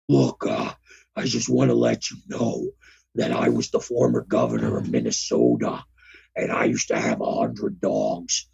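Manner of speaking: very low, gravelly-free deep rumble; Jesse Ventura impression. Playing Jesse Ventura for cohost, very low voice. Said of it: "going back to my Conan thing".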